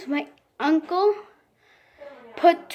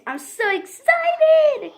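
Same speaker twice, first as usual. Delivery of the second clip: high, slow squeal of delight; squealing excited; 13 semitones higher, 4 dB louder, much slower than usual. Real, not acted. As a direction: stretch it out, thrilled. Opening the new toy.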